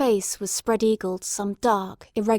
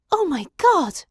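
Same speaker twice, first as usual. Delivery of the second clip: a shocked cry, pitched up; horrified gasp; 8 semitones higher, 4 dB louder than usual. A narrator's reading. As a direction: gasp it out in sudden horror. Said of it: "I gasped".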